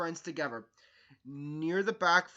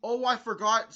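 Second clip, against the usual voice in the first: ordinary delivery, nothing marked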